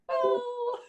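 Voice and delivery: a sudden high, startled oh; surprised gasp. Retold or imitated. Imitating dev's reaction in code.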